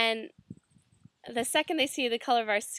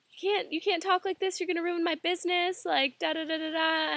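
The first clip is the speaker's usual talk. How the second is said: higher, mock-pleading; protesting shopkeeper; 7 semitones higher than usual. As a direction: playfully beg her to stop. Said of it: "He's just like".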